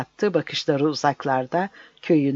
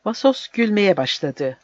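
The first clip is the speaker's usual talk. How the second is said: ordinary delivery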